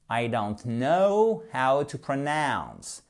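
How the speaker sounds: slow English accent with long gliding diphthongs; corrected English accent. Shown as a demonstration. Teacher showing learners the proper way, slow English accent. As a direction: model careful English accent, stretching "know" and "pronounce". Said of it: "Je veux plus que vous prononciez".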